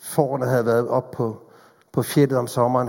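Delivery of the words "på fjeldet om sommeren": normal talking voice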